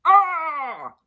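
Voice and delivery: a high loud scream. Acted. A distant victim screaming high and loud. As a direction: scream out in terror.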